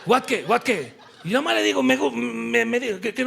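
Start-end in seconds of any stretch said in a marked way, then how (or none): none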